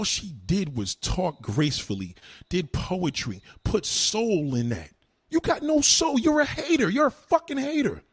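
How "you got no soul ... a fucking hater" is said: high, loud furious yelling; real outraged shout; angry shout. Real, not acted. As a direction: blast the insults at her. Ranting insults at a rival.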